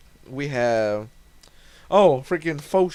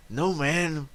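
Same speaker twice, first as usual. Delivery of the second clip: character voice; 3 semitones higher than usual. Acted. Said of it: "I cannot keep continuity in my voices".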